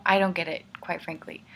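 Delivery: normal talking voice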